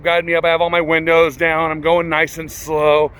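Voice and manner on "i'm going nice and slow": ordinary talk, nothing marked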